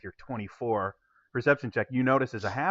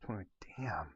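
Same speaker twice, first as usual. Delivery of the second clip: whispered curse; 5 semitones lower, 16 dB quieter than usual. Real, not acted. GM reacts to a high roll.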